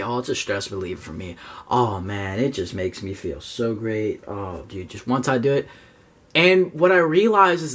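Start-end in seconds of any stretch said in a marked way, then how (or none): 0.0-5.7 low, soft mocking voice of people excusing themselves; mocking imitation. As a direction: mimic them lazily, low and hushed. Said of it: "They say".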